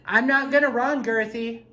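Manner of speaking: high, bold challenge to a ghost; defiant taunt. Real, not acted. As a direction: dare the spirit to act. Taunting the spirit Girthy.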